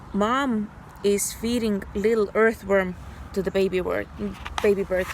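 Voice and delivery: ordinary talk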